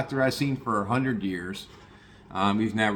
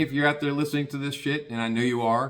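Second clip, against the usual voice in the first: ordinary talk, nothing marked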